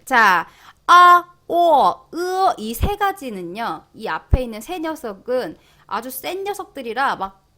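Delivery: normal talking voice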